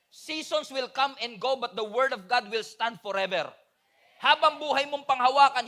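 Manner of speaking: normal talking voice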